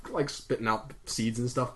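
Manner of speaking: ordinary delivery, nothing marked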